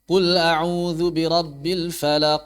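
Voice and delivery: slow recitation, "falaq" ending in a drawn-out vowel bounce; qalqalah dipanjangkan. Shown as a demonstration. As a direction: stretch the bounce after q in "falaq". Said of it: "jangan dipanjangkan". (pantulan akhir "falaq" dipanjangkan seperti harakat)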